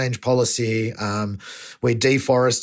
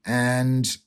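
ordinary talk, nothing marked

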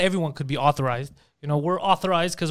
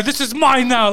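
louder, higher mock possessive shout; retold yelling; 6 semitones higher, 5 dB louder, slower than usual. Retelling how he yelled at the cop, mock shouting. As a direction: act out yelling it at someone, raised and high. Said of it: "Just yelling at her".

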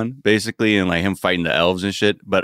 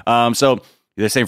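usual speaking voice

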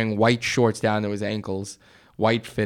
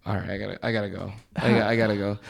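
nervous mumble; 5 semitones lower, 7 dB quieter, much faster than usual. Retold or imitated. Nervously leaving the stranger.

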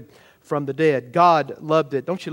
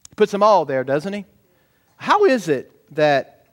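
ordinary delivery

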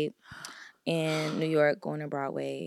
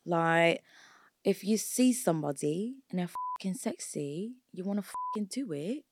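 put-on playful London accent. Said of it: "that London accent is really good".

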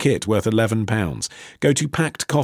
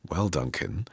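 ordinary delivery

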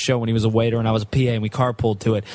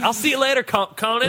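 high stammering goodbye; 9 semitones higher than usual. Retold or imitated. Retelling Rick's stammered exit.

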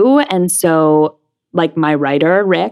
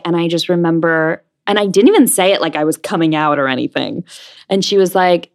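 ordinary talk, nothing marked

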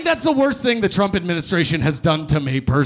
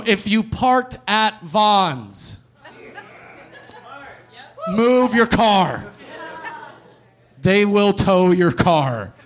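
slow warning; 3 semitones higher, much slower than usual. Acted. Host warns about towing.

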